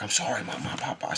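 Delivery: quiet, hushed apology; soft apology. Retold or imitated. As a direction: murmur sorry to the elders. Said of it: "I be like".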